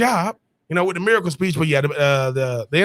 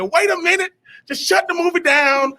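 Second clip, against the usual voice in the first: excited reaction; 10 semitones higher than usual. Retold or imitated. His own excited reaction retold.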